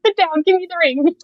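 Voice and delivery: high and loud, rushed with joy; laughing eagerness to grab the ring; giddy yes. Retold or imitated. Answering the proposal at dinner, high loud giddy excitement.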